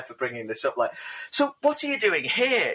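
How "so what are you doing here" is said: high-pitched chat show host; lightly voiced host teeing up a clip, pitched up; Graham Norton. Host quizzes guest about footage, high presenter voice. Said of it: "that Graham Norton, because he has such a flair".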